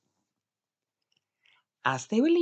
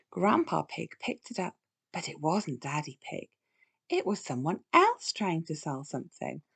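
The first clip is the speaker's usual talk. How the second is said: plain reading voice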